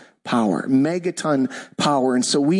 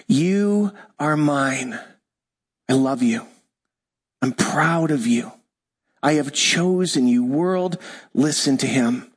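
low, slow blessing with long pauses; tender declaration; 3 semitones lower than usual. Shown as a demonstration. Pastor voicing the Father over Jesus, tender and low. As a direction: speak it gently over a beloved son.

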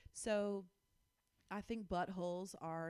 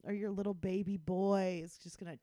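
normal talking voice

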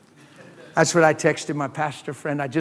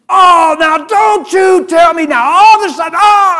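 mock outraged shout; 12 semitones higher, 9 dB louder than usual. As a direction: shout it like someone who's just been insulted.

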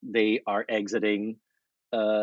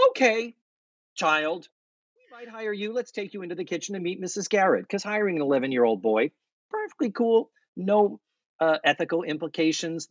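higher, mock-sweet sarcastic voice; sarcastic girls; 6 semitones higher than usual. Host mocking the girls hiring a boy, high and sarcastic. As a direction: gush at the kid, dripping irony. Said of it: "So the girls say".

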